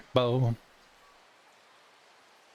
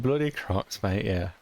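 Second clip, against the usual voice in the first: ordinary talk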